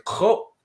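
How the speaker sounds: Ko short and high with a throaty start; a guttural, cut-off Ko; Klingon refusal. Shown as a demonstration. Teacher showing how to refuse a request, short guttural Ko. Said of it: "you can just say".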